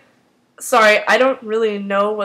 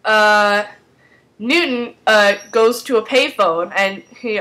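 ordinary talk

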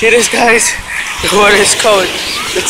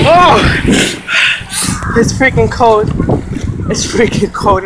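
cold shock; 6 semitones higher, slower than usual. Real, not acted. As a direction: yelp as the cold water hits. Going deeper into cold water.

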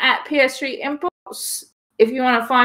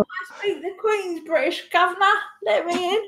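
high-pitched put-on British; fake British accent; 9 semitones higher, 3 dB quieter than usual. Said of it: "me putting on a fake accent".

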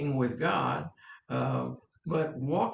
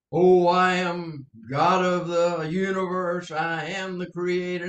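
grand divine proclamation; 5 dB louder than usual. Acted. Preacher imagining God announcing himself to the world, mock-grand proclamation.

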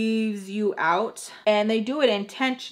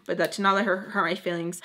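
usual speaking voice

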